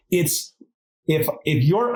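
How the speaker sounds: ordinary talk, nothing marked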